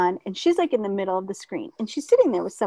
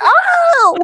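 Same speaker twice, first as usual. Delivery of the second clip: startled; 7 dB louder than usual. Retold or imitated. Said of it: "she's like".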